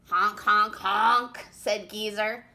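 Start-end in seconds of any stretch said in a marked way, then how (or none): 0.1-1.5 loud goose honking; grouchy honks. Acted. Grouchy goose honking at the chipmunk. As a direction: honk it out like a cranky goose. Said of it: "who sounded grouchy even when he wasn't".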